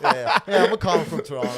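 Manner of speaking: fast, loud, proud boast; loud bragging. Retold or imitated. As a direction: show off to the whole room. Bragging line repeated.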